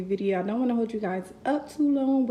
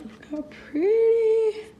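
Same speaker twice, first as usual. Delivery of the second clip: much higher, much louder, slow and gushing; delighted squeal; 13 semitones higher, 9 dB louder, slower than usual. Real, not acted. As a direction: squeal over something adorable. Delighted reaction to case color.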